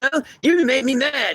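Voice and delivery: high, mocking shout of anger; imitating Eve yelling, high and mocking; mock angry yell. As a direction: squeal it like a petulant yell. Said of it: "yells at them and says".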